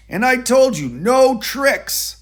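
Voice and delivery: the man scolds the monkey, loud and high; scolding. A narrator's reading.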